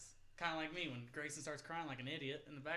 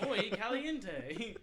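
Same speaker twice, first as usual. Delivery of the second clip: mock Spanish; 5 semitones higher, 5 dB louder, much slower than usual. Joking Spanish aside.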